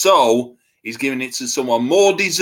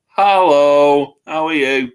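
louder, put-on local hello on the phone; put-on Harlow greeting; 3 semitones higher, 6 dB louder than usual. Answering a colleague from the Harlow store, louder mock hello. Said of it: "and I went".

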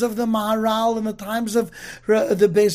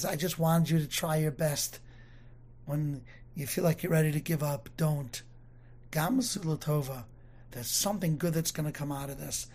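softer and lower, a tender fatherly voice; gentle reassurance; 6 semitones lower, 10 dB quieter than usual. Acted. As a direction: comfort a child who wants to give up. Heavenly Father comforts the people.

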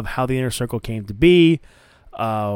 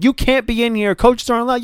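higher, firm mock referee; stern referee; 9 semitones higher than usual. Referee keeping Jericho out of the booth: firm mock scolding. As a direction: shut him out, strict. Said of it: "She's like".

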